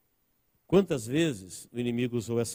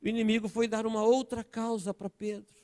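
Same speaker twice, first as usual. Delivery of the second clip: raised, emphatic sermon delivery with stretched key words; ringing pulpit declaration; impassioned preaching; 9 semitones higher, 5 dB louder than usual. Real, not acted. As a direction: preach it out to the congregation. Preaches at full force.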